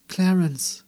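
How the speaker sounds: anxious search call; desperate call. A narrator's reading. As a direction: cry out for him in desperation. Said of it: "Verzweifelt suchte sie die Winkel ab".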